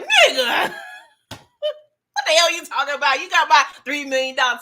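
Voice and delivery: shouting in disbelief at his partner; angry shout. Retold or imitated. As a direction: bark it at him like he's crazy. Franklin yelling back at Unc.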